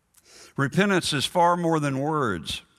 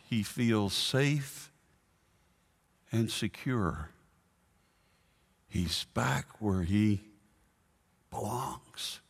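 soft and slow; 2 semitones lower, 6 dB quieter, slower than usual. Real, not acted. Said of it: "preacher softens on homecoming".

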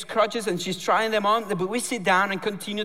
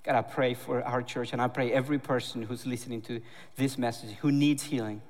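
lower, soft prayer voice; closing prayer over the church; 4 semitones lower, 4 dB quieter than usual. Acted. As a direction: pray quietly and reverently. Pastor leads a closing prayer.

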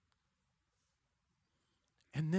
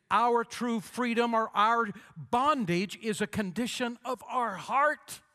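high, loud pulpit preaching; full-force preaching; 6 semitones higher, 5 dB louder than usual. Acted. Pastor preaching freedom to the congregation, at full force. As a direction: drive the point home from the pulpit.

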